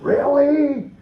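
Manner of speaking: high, drawn-out disbelieving really; a parent doubts the alarm clock trick; incredulous. Retold or imitated. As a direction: sound like you can't believe it. Mock incredulous parent question.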